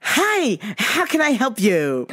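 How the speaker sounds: high, drawn-out customer-service greeting; exaggerated polite retail voice, slow and lilting.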